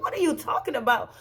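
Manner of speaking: high-pitched incredulous question; retold alarm at the news; acted-out stunned demand for answers; shocked disbelief. Shocked reply to bad news.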